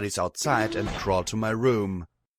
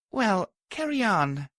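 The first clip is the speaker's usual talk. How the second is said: higher, slow and easy; lightly; 11 semitones higher than usual. A narrator's reading. Tired mare letting Glory keep working, light. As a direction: let her carry on, relaxed. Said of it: "I replied lightly".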